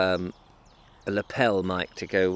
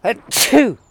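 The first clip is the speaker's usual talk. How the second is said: sudden high explosive sneeze; loud sneeze; 13 dB louder than usual. Real, not acted. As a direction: let a big real sneeze out. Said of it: "I'm going to sneeze".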